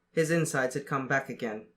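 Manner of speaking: usual speaking voice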